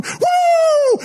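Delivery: high, loud whoop; mock whoop. Preacher imitating staff whooping, very high and loud. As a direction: let out a hyped woo.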